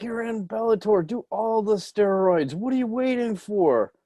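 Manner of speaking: high, egging-on pep talk; mock pep talk. Urging a fighter to use steroids, high mock pep talk. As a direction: cheer him on to the steroids. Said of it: "I'm like".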